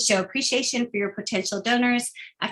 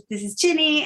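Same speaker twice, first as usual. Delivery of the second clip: cheerful phone greeting; 7 semitones higher than usual. Shown as a demonstration. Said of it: "but, um".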